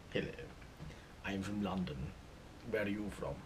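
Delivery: put-on London accent with something stuck in the throat. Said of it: "talk like they've got something stuck in their throat".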